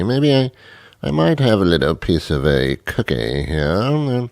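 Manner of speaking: unhurried, languid mock-genteel voice; hammed-up dreamy tea drinker; laid-back tea drinker. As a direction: stretch the words out as if nothing could hurry you. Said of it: "A tea drinker is the opposite".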